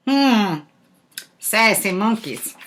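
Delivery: plain reading voice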